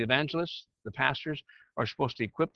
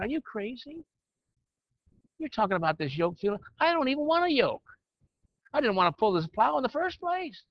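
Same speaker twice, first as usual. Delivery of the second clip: a higher, complaining ox voice; grumpy ox; 8 semitones higher than usual. Acted. Teacher voicing the unwilling ox in his parable, higher and whiny. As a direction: whine it like a fed-up ox pulling a plow.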